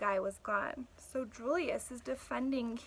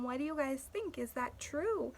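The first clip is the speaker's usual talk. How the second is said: ordinary talk, nothing marked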